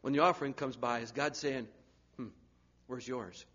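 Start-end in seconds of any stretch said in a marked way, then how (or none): 2.2-3.5 softer, probing question; soft nudge. Retold or imitated. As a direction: gently needle the listener. God voiced asking for tithe.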